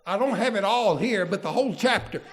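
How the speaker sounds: normal talking voice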